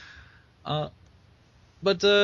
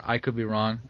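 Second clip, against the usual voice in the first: usual speaking voice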